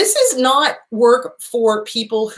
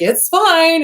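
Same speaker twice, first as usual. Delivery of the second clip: high, louder mock cheer; meme cat in denial; 9 semitones higher, 5 dB louder than usual. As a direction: insist all is okay while the house burns. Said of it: "meme where the cat is like".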